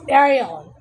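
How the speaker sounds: exasperated shout of Ariel; sharp call. Real, not acted. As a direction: call her name to stop her. Scolding Ariel beside her.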